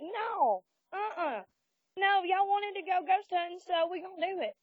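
high-pitched, playful objection; retold insistent refusal. Refusing to let spooked buddies leave, playfully insistent.

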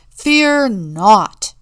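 loud, proud town leaders; booming reassurance. A narrator's reading. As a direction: boom it out grandly.

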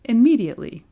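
emphatic nurse's order; insistent. A narrator's reading. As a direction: insist on it forcefully. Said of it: "insisted the nurse".

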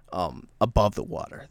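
ordinary talk

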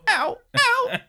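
high-pitched mock yelps of pain; squeaky little "ow" cries; Karen Tendo. Girl turned to dust being stepped on, squeaky yelps. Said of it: "you hear her go".

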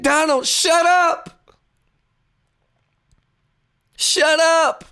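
plead-yell it, stretching "up"; party's shut up. Retold or imitated. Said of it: "the Republicans are saying".

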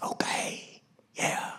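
put-on child's obedient whispered agreement; imitated hushed kid. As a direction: whisper back like a little kid.

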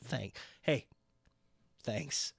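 low, soft grumbling dismissal; annoyed brush-off. Retold or imitated. CM Punk brushing off fans, low and soft. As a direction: wave them off wearily. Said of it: "he's like".

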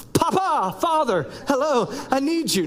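high, insistent mock-child calling; playful high-voiced plea for attention; pleading kids. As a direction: call for dad like a needy kid. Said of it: "they've never called me that".

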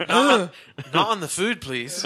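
loud, high-pitched plea to stop; acted baker protesting at a customer; alarmed shopkeeper. Baker reacts to coughing at stall.

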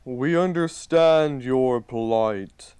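slow, solemn ancient tree voice; talking tree. Acted. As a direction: lay down a challenge, slowly. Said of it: "The giant tree goes".

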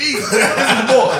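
put-on "boy" stretched very slow with a laugh; mock the owning "boy", dragging it out; drawn-out boy. Shown as a demonstration. Laughing demo of how they say boy. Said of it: "the way the motherfuckers say that B".

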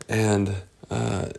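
ordinary delivery

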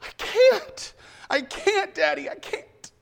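a high anguished cry on Daddy, fading to a broken quiet I can't; begging in anguish, voice collapsing to near silence. Acted. Jesus in Gethsemane cries to his father, anguished then fading. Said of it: "Jesus is crying out".

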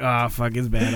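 normal talking voice

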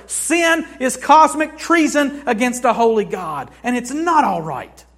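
loud, rising pulpit preaching; full-force preaching. Acted. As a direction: hammer each line home to the church.

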